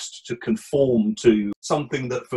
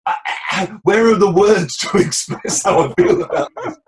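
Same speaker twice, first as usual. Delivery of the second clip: high, loud, laughing frustration; sputter, then laugh at being stuck; exasperated groping for words; 6 semitones higher, 4 dB louder than usual. Retold or imitated. Frustrated speaking Indonesian, lacking words.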